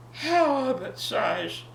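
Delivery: plain reading voice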